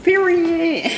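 high, loud burst of delight; excited exclamation. Real, not acted. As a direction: pop the word up, thrilled. Excited reveal of cabinets.

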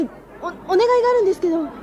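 quick, stammering request; nervous rush. Acted. Girl shyly asks the stranger a favour, fast and flustered.

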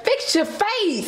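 slow, high mock scold; mocking husband. Husband teasing wife to fix her face, slow and high. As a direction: drawl it out like a teasing order. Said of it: "I'm you".

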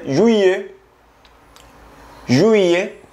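juillet, the French way; French pronunciation. Shown as a demonstration. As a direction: juillet à la française. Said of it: "julio se pronuncia".

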